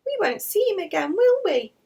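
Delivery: put-on high, loud child asking sadly; a small boy hoping to be wrong; little boy's voice. Alfie asks his mum about the dead cat, high child voice.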